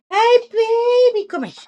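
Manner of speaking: sing-song puppy greeting. Real, not acted. Greeting her dog lovingly.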